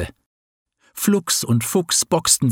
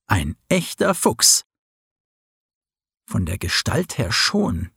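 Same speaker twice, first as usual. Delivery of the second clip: plain reading voice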